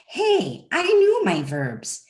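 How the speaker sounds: lightly acted wounded pride; mock indignant protest. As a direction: protest with a high "Hey", then stretch it out. Voicing a learner protesting a correction.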